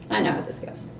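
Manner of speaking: snide mutter. Retold or imitated. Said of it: "That's how I sounded".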